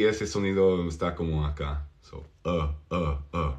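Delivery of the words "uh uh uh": low, soft "uh"; relaxed schwa. Shown as a demonstration. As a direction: say "uh" with slack mouth. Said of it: "se relaja la boca".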